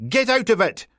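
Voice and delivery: high, loud Peterhead order to clear off; angry Peterhead shout. Acted. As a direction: shout in hard Peterhead Scots. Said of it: "A hard Peterhead accent blared out".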